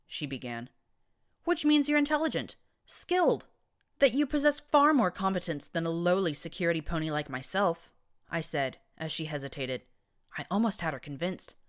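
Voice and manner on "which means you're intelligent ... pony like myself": flattering. A narrator's reading. Flattering to win her over.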